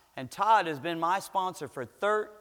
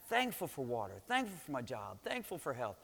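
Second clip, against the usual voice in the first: low, very soft list-making; quietly noting things down to oneself; murmured list; 6 semitones lower, 10 dB quieter than usual. Acted. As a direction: tick items off under your breath. Murmuring sample gratitude list entries.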